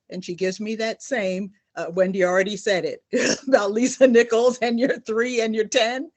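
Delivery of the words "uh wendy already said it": ordinary delivery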